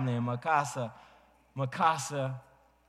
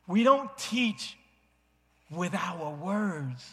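high then slow, leaning on teach; slow emphatic point; 5 semitones higher, slower than usual. Real, not acted. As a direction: drive the lesson home slowly. Sermon climax line.

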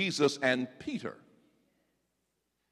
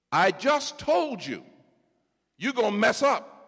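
Jesus insisting; 10 semitones higher, 6 dB louder than usual. Acted. Jesus repeats warning.

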